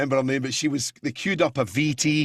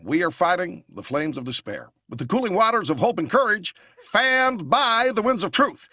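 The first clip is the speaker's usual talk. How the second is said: Obama impression; 2 semitones higher than usual. Guest on a talk show doing Obama's grand speech. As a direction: preach it like a hopeful campaign speech. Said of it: "Then we had Obama".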